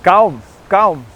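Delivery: high, loud alarmed yelling; shouting at a dog. Real, not acted. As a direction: yell at a dog to back off. Dog spraying him with water.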